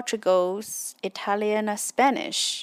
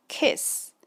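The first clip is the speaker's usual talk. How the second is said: usual speaking voice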